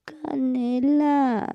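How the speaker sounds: high, slow coy answer; sweet falsetto name, stretched; coy girly reply. Retold or imitated. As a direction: coo the name up high, drawn out.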